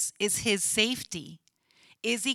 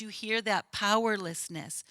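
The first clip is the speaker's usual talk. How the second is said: ordinary talk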